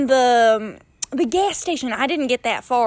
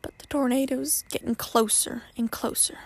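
whisper; 7 dB quieter than usual. Real, not acted. Tense storytelling of approaching tornado.

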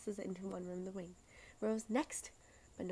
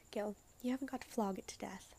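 usual speaking voice

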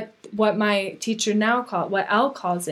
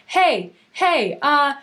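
high warning voice, building louder into a laugh; inner alarm speaking up; 7 semitones higher, 5 dB louder, much slower than usual. Acted. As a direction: playfully sound the alarm, pitch climbing. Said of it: "That navigation starts to speak up".

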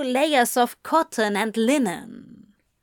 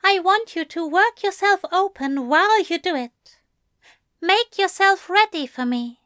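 high, quick character voice; brisk angel, lilting high; angel setting terms; 9 semitones higher, faster than usual. A narrator's reading. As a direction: give instructions pitched well up. Angel giving terms to demon.

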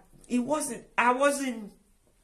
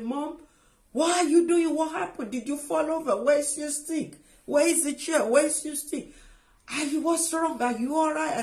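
higher-pitched, imitating an alarmed daughter; retold alarm in a raised pitch; startled daughter; 6 semitones higher than usual. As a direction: fire off worried questions as the daughter. Startled daughter questions mother, retold.

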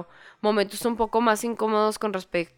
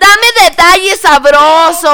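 slow, louder teasing plea; mock seductive; 5 semitones higher, 15 dB louder, slower than usual. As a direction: drag it out like juicy gossip. Host teasing for juicy details. (pidiendo chisme con voz pícara)

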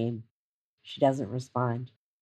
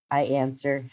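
ordinary delivery

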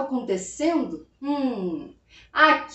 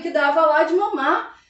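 ordinary delivery

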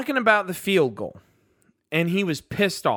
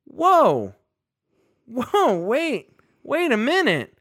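surprised; 5 semitones higher, much slower than usual. Real, not acted. Catches a contradiction in the quote.

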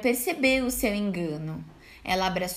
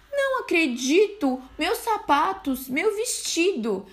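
dismayed; 7 semitones higher than usual. A narrator's reading. Wolf dismayed at ruined clothes.

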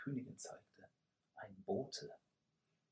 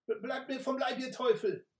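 high, loud, terrified cry for help; trembling cry; 10 dB louder than usual. Acted. Messenger cries out in fear at the attackers. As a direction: shriek them away in panic. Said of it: "rief jemand mit zitternder Stimme".

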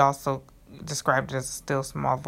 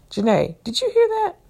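high, loud startled question, acted out; acted-out disbelief, voice shooting high; astonished question; 6 dB louder than usual. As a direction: ask it in amazement, pitch leaping way up. Mother startled, asking infant daughter.

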